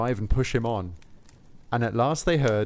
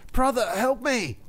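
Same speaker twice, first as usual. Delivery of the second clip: crying for help; 12 semitones higher than usual. Acted. Drowning soldier to Saint Peter, high panicked cry. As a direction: call out as the water rises. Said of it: "Then he cried".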